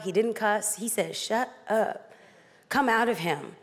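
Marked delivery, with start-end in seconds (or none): 1.2-3.6 low, restrained rebuke; stern command. A narrator's reading. Jesus commands the impure spirit to leave, sternly. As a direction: order it out firmly, no yelling. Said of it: "Jesus said sternly".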